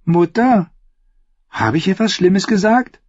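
put-on high, innocent young boy; small boy asking timidly; child voice. A narrator's reading. Child voice, Tommen.

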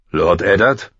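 questioning call at ordinary pitch; man calling. A narrator's reading. Man calling Eddard out of the dark, rousing call. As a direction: call to a sleeper, lifting at the end. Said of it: "hallte die Stimme eines Mannes".

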